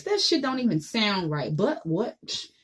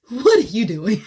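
laughing; 4 semitones higher, 10 dB louder than usual. Real, not acted. Laughing at her own joke.

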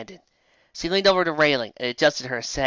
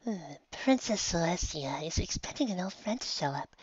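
hushed secret; 4 semitones higher, 10 dB quieter than usual. Acted. Featherfall whispers secret.

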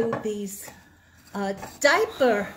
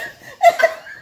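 shrieking laugh; 8 dB louder than usual. Real, not acted. Laughter at the story.

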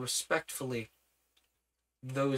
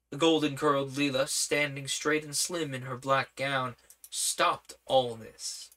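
ordinary delivery

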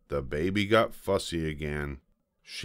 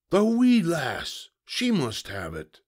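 pirate holler; 11 semitones higher than usual. A narrator's reading. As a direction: holler in sudden realization. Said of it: "Braidbeard hollered".

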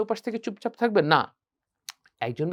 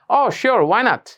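bright, loud Oh; happy; 4 semitones higher, 5 dB louder, much slower than usual. Shown as a demonstration. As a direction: answer gladly. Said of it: "খুব একটা খুশি নিয়ে". (খুশি হয়ে উত্তর)

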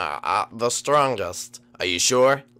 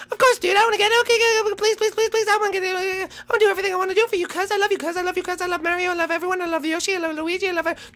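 ecstatic Lemmy; much faster than usual. Acted. Lemmy greets his cousin in a high manic squeal. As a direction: bounce off the walls with giddy glee.

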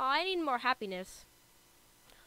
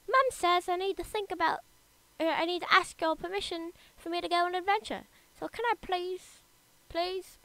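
put-on higher-pitched pleading British accent; 5 semitones higher than usual. Young adventurer asking the ruler's permission, British accent. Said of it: "I gave him a British accent".

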